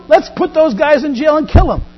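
high, loud mock outrage; rally the crowd against them; acted townsfolk's outburst; angry mob.

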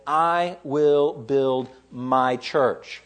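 "I will build my church" very slow, each word set apart; slow emphatic quote. Retold or imitated. Preacher quotes Jesus to show who is building, slow and emphatic.

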